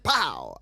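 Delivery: loud punchy pow; sharp loud shouted pow. Retold or imitated. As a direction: slam it out like a hard tap stomp. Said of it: "And he goes".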